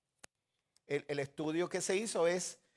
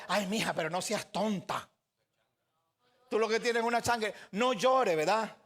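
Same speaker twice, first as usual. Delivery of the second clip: dismissive husband; 3 semitones higher than usual. Retold or imitated. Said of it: "usted como caballero no le diga".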